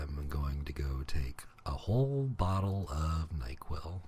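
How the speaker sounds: soft tired whisper. Real, not acted. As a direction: whisper the sign-off. Whispered sign-off.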